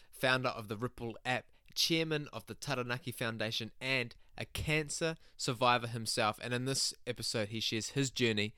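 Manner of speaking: soft, even intro read; podcast intro. Acted. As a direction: read the episode intro calmly. Pre-recorded podcast intro read.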